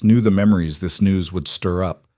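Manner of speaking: ordinary delivery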